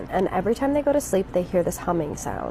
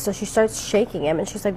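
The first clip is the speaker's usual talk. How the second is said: ordinary delivery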